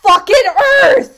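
high, loud strained yell; exasperated shout. Retold or imitated. Speaker shouts at everyday mishaps, strained high yell. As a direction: explode at the small annoyance. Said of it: "it'll be like".

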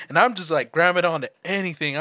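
ordinary delivery

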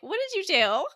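high-pitched acted question, rising at the end; put-on high questioning voice; mock accusing question. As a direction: pitch it way up, playfully suspicious.

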